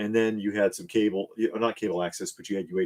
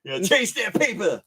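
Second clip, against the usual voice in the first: drug dealer voice; 11 semitones higher, 4 dB louder than usual. Pretend. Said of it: "[name]'s drug dealer voice".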